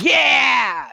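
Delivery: high loud cheer; thrilled yell; excited cheer. Retold or imitated. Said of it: "was just like".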